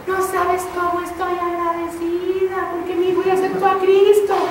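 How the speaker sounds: a mother pouring out gratitude to the teacher; grateful mother. Retold or imitated.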